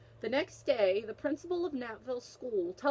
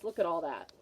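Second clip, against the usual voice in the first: ordinary delivery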